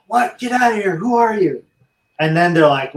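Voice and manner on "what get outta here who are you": patient hearing cancer news from a stranger, high startled mock yell; retold startled yell. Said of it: "I yelled".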